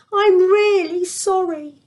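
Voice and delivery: high, slow, pleading apology; second servant on his knees begging for mercy; pleading cry. Acted. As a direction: stretch it out, begging. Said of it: "he cried".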